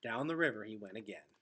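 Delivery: ordinary delivery, nothing marked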